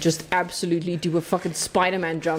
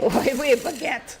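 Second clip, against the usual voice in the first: mock French accent; 5 semitones higher, slower than usual. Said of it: "Just for the sake of this".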